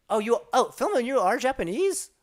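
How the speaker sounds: loud, high astonished question; stunned by the news; real surprise. Real, not acted. Streamer stunned that chatter Filma is Japanese, loud and high.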